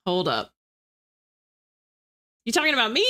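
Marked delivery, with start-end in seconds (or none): 2.4-3.1 high, incredulous check; taken-aback question; surprised. Real, not acted. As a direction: ask it high, as if singled out unexpectedly. Still reacting to accent comment.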